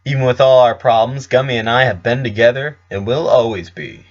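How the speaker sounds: plain reading voice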